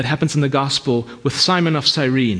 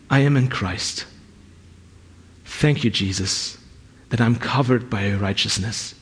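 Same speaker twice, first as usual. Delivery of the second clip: morning prayer; 8 semitones lower than usual. Shown as a demonstration. Preacher sharing his daily prayer, hushed. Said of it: "this is my prayer".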